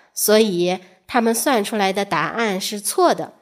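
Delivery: plain reading voice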